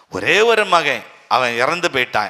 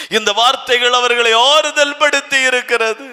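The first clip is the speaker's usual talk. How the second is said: higher and louder, ஆறுதல் lifted and ending on a rise; emphatic repeat; 9 semitones higher, 3 dB louder than usual. Shown as a demonstration. Preacher repeating the line to the church, higher and louder. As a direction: hammer it home the second time. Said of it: "repeated louder".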